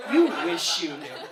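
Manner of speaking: loud punch on the first YOU; emphatic retort. Retold or imitated. Muddy customer repeating the retort to shop workers, loud and emphatic. Same reply repeated for the laugh.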